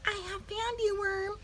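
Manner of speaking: high, soft little bird voice; small bird voice. A narrator's reading. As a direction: ask like a tiny curious chick. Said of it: "said Early Bird".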